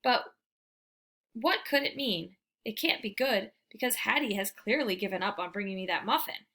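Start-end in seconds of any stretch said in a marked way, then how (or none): none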